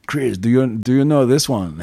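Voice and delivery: lightly put-on Italian accent; attempted Italian accent. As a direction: have a rough go at an Italian accent. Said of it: "I can't do his ac-, his accent's great".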